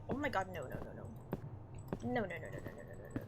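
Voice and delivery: low, soft string of noes; dismayed muttering. Real, not acted. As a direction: mutter in dismay, fading away.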